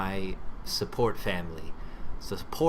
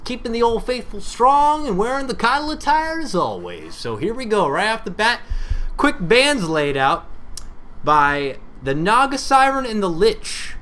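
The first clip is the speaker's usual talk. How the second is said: hyped draft commentary; lively esports caster call; 9 semitones higher, 5 dB louder than usual. Acted.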